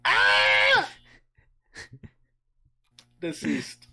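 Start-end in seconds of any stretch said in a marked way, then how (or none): 0.0-0.9 very high startled shriek. Acted. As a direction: let out a sudden bird shriek. Sudden shriek.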